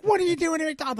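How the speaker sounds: high-pitched mock wife voice; put-on high voice, questioning; imitated wife. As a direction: imitate a wife's incredulous question. Voicing his wife's question.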